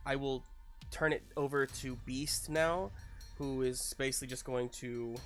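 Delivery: ordinary delivery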